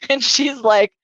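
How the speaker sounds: usual speaking voice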